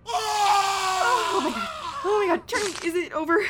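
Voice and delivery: scared shriek. Acted. Spooked by the movie.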